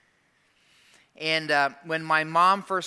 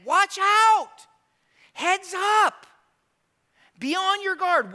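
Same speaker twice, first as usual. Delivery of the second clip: much higher and louder alarm call; emphatic warning; 8 dB louder, slower than usual. Shown as a demonstration. Preacher repeating Jesus' warning to the church, shouted. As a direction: sound the alarm for everyone. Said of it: "Jesus so emphatic in saying".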